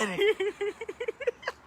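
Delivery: soft breathless giggle; breathless laugh. Real, not acted. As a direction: laugh so hard you can't speak. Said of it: "I can't even talk".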